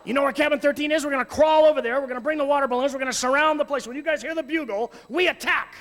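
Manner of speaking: mock commander briefing his cabin; rallying the troops. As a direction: pump up the boys like a general. Leader rallying troops, loud.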